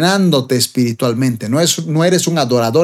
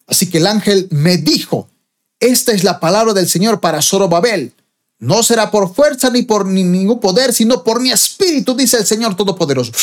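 high, emphatic declaration of the verse; prophetic scripture proclamation; 6 semitones higher than usual. Acted. Angel's word to Zerubbabel, read as high prophetic proclamation. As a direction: proclaim it with raised, driving pitch.

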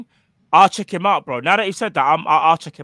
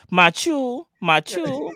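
imitating Bajan accent; 8 semitones higher, much slower than usual. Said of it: "the way they'll say that".

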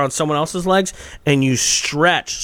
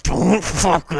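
through gritted teeth; 2 semitones higher, much slower than usual. Retold or imitated. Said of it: "one of my best attributes".

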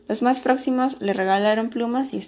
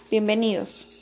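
plain reading voice

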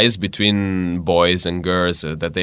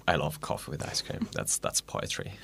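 dreamy; 6 semitones lower, 14 dB quieter than usual. Real, not acted. Dreamy praise of iced coffee.

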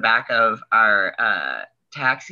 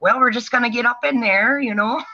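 put-on high, thick Sheboygan accent; 8 semitones higher than usual. Proctologist about to examine a patient, in a thick Sheboygan accent. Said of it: "Sheboygan sort of accent".

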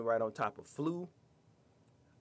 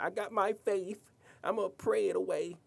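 high sing-song mockery of blind faith; mocking the faithful; 8 semitones higher than usual. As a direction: parody someone sure prayer will save them.

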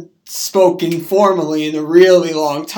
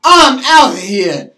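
loud, slower, higher retort; acted angry exit; 6 semitones higher, 9 dB louder than usual. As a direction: announce a walkout in the retold fight. Quarrel quote acted out.

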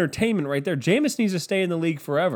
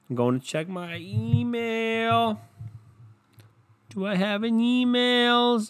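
slow sing-song; 6 semitones higher, slower than usual. Pretend. Acting out checking email.

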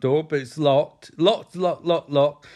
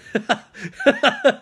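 high, loud burst of real laughter; 10 semitones higher, 6 dB louder than usual. Real, not acted. Narrator cracks up at the dad's curse, loud laugh.

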